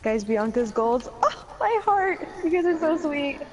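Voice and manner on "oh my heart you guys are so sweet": touched. Real, not acted. Streamer thanking chat for kind words, touched high coo.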